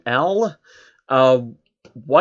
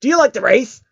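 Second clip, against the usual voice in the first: higher, louder eager challenge; higher louder brash pony voice; tomboy pony; 9 semitones higher, 5 dB louder than usual. Acted. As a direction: ask it like an eager sporty pony. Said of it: "Rainbow Dash went first".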